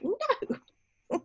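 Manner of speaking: squealed laughing no; high squeal of a no, cracking up. Retold or imitated. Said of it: "and I'm like".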